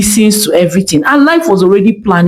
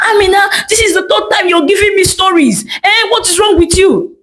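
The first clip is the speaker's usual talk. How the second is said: angry customer; 11 semitones higher than usual. Acted. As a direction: shout at the tailor, fed up and near tears. Angry customer in shop quarrel.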